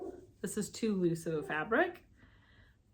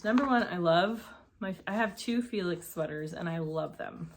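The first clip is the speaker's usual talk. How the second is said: usual speaking voice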